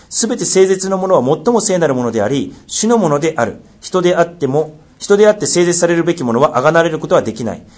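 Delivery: measured, solemn Bible reading; scripture reading. Acted. Pastor reading scripture to the church, solemn and measured. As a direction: read the law aloud to the congregation. Reads Leviticus aloud.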